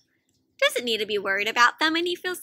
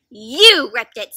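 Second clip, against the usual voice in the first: angry blaming; 3 semitones lower, 10 dB louder, slower than usual. Acted. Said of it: "said Squirrel".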